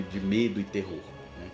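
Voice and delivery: ordinary talk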